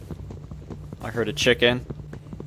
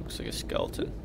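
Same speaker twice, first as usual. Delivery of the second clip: hushed whispered aside; soft whispered remark; 16 dB quieter, faster than usual. Real, not acted. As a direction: whisper it to yourself. Quiet aside while exploring.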